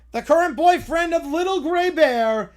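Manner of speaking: ordinary delivery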